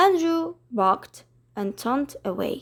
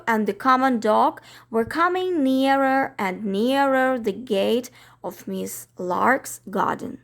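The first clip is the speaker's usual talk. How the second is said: usual speaking voice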